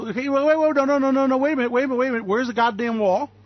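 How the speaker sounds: high, rapid-fire protest; indignant interruption. Real, not acted. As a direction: break in with heated, repeated objections. Ranting, demanding promised results.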